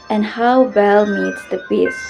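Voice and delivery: ordinary delivery, nothing marked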